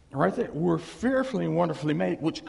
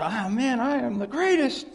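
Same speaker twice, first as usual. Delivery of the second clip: loud bragging, higher and louder; boastful; 7 semitones higher, 5 dB louder than usual. Retold or imitated. Preacher mimics a proud believer bragging loudly. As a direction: crow about being the greatest. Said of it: "you over here say".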